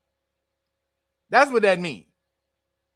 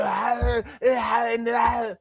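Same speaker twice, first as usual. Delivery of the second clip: mock raspy grunts; 6 semitones higher, much slower than usual. Mocking the lawyer's hoarse stammer with strained rasps. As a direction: croak out each "ah" like a man with a sore throat. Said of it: "'cause he said".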